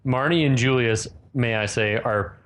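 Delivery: ordinary talk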